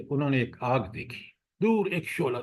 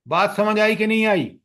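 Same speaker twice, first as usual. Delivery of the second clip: loud, fast question to the students; calling out to class; 7 semitones higher, 6 dB louder, faster than usual. Real, not acted. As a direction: demand an answer from the room. Teacher checks class understanding. (طلبہ سے بلند اور تیز سوال)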